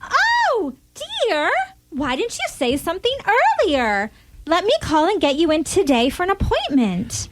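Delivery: high, sweet, doting voice; dotty wife. Acted. Wife sweetly misunderstands her husband, high and doting. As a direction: coo at him without listening.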